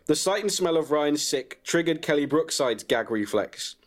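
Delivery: usual speaking voice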